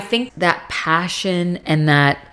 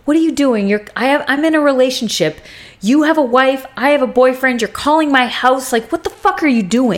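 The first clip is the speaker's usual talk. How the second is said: retold frustration, each complaint pitched up; super frustrated; 6 semitones higher than usual. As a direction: imitate a woman confronting her married ex. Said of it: "she's super frustrated with him".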